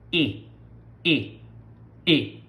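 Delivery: "E" as a short, pure single vowel; Portuguese letter E. Shown as a demonstration. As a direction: say "E" as one clean vowel, no glide. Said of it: "which we pronounce in Portuguese".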